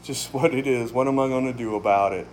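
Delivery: mock the giving-up attitude, low and listless; resigned shrug. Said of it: "some people's attitude is".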